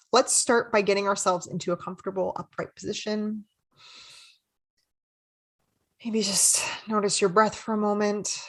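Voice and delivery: calm, unhurried meditation guide; soothing, measured mindfulness voice; guided meditation. Real, not acted. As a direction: lead listeners gently with long pauses.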